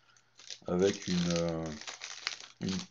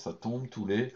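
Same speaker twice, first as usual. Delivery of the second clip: normal talking voice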